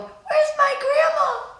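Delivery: high, slow, loud call; child's yell. A narrator's reading. Child calling for grandma at the fire, high slow yell. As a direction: call out for grandma across the street. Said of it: "I yelled".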